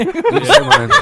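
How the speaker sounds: high, laughing squeal; laughing while saying it. Real, not acted. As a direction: giggle it out, delighted. Laughing permission to say name.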